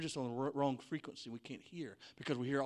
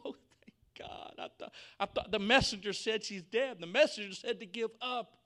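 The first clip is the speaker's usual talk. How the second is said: excited relief; 8 semitones higher, 7 dB louder than usual. Acted. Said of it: "can you imagine the excitement of Jairus".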